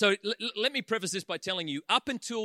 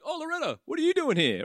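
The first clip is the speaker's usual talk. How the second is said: mock greeting; 8 semitones higher than usual. Teasing mother-in-law via witch.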